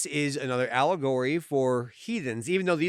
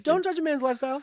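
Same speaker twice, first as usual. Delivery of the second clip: indignant high voice; 12 semitones higher, faster than usual. Acted. Defending the cat hoarder.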